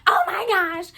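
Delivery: high, frantic manager voice. Retold or imitated. Manager begging for shift cover, high panicked voice. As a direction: play her panicked and overwrought.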